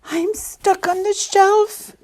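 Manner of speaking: high, loud, slow elf cry; distressed elf wail. A narrator's reading. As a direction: cry for help in a piping elf voice. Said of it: "cried the elf".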